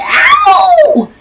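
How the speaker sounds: high loud squeal of pain, laughing; mock ow. Mother voices the stomped mouse with a laughing squeal. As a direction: squeak out a cartoon ouch.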